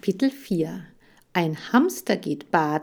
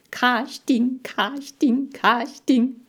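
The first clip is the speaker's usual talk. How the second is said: high, bouncy cheering chant; jubilant, full cheeks; 6 semitones higher, 4 dB louder, slower than usual. A narrator's reading. Hamster Chan cheers about the casting, jubilant. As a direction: cheer each word through stuffed cheeks. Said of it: "jubelte Chan mit vollen Backen".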